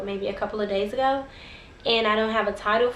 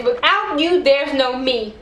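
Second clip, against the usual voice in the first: loud, raised-pitch acted ultimatum; desperate threat; 3 semitones higher, 7 dB louder, slower than usual. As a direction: declare it forcefully, as a desperate plea. Man threatens with gun in hand.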